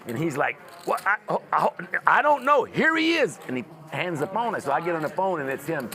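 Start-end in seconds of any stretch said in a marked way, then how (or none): 0.9-3.4 higher and louder, caught off guard; flustered surprise. Retold or imitated. Friend startled by the angry caller, high flustered stammer.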